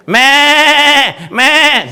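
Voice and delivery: high, drawn-out sheep bleat; imitated bleat. Ram appears to weeping Abraham, high bleating. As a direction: bleat loudly like a ram, twice. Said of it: "se suelta llorando delante de Dios".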